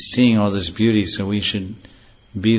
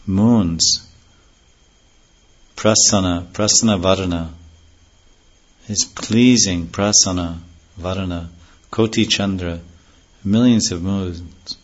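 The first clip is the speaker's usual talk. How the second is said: ordinary talk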